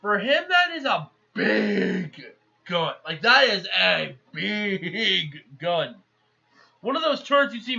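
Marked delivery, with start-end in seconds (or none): 0.5-3.0 "big" drawn out long before "gun"; stress on big. Real, not acted. Emphasising the gun's size. 3.2-6.0 very slow, with "big" stretched right out; stress on big. Real, not acted. Repeats it for more emphasis.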